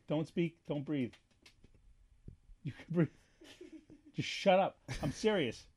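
quiet stern commands so the recording goes on; hushed commands. Real, not acted. Hushing someone during recording.

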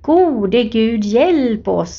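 ordinary talk, nothing marked

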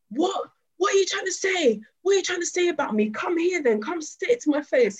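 high-pitched mock aggression, squaring up; confrontational challenge. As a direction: imitate a man picking a fight. Acted confrontation of the passing cyclist.

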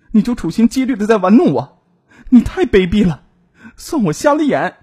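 high, loud, indignant accusation; ashamed and furious. A narrator's reading. Jilted woman phones her lying lover, ashamed and furious. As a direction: lash out in outrage, voice pitched high. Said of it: "又羞又气".

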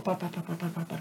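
soft, murmured pá pá pá; hushed pá pá. Retold or imitated. Retelling the sound engineer's quiet reaction, hushed. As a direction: mutter it almost under the breath.

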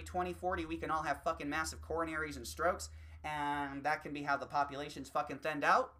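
normal talking voice

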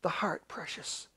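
slow, very soft assurance; hushed. Acted. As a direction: breathe it out slowly and quietly. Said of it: "In the spirit that cries out".

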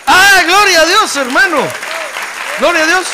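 real full-force high shout of praise; loud, high preacher's holler. Real, not acted. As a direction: cry out in worship to the congregation. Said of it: "expresamos la alabanza a Dios a gritos".

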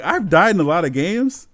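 loud, high-pitched retold outcry; exclaimed disbelief. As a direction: relive the outrage at full volume. Retelling shocked reaction to game death.

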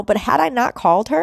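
ordinary talk

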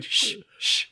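two hushing hisses; imitated shushing. Kid between the seats hushing the talkers, imitated shushing. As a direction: mimic a kid shushing through a gap. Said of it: "he kept going".

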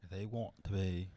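quietly mimicked English-type accent; imitated accent. Co-host mimicking the accent he heard, imitated English accent. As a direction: echo the phrase in a put-on English accent. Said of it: "Why'd you do that accent?".

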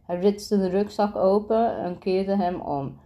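plain reading voice

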